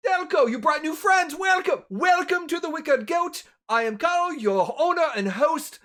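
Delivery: loud, high, exuberant host; happy, energetic innkeeper. Acted. Owner introducing himself to new guests, exuberant. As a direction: greet them like long-awaited guests, full volume. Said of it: "just happy, energetic".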